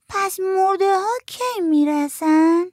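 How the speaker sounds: put-on high, slow, insistent child question; little girl voice. A narrator's reading. Little girl asks her aunt again when the dead arrive, high child voice. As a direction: ask again slowly, like a small girl pressing.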